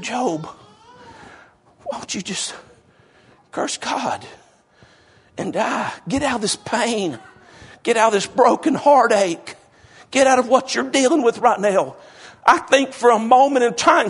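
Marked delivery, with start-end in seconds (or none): 0.0-12.0 much softer, pleading and compassionate; tender wife. Retold or imitated. Grieving wife urges Job to end his pain, soft and tender. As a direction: beg him gently out of love. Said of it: "I think she said".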